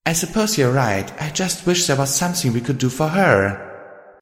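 understated, resigned character voice; sighing concession. A narrator's reading. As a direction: concede with a touch of regret. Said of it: "my host sighed".

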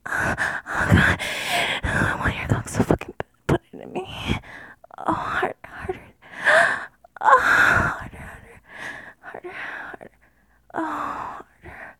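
urgent, breathy gasped whisper; too-loud whisper. Pretend. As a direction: let the whisper surge and slip out of control. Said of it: "I'm being a little too loud".

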